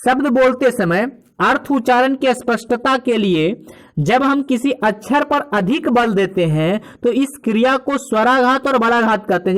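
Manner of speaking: ordinary delivery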